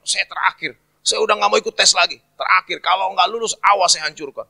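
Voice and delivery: high-pitched acted threat; very angry. Young man warning Mary after failing, acted anger. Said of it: "Wah, dia marah sekali".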